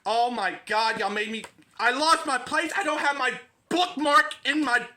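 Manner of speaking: high, loud, exasperated yelling; annoyed yelling roommate. Acted. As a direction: yell through the wall to shut them up. Third voice yells from next room.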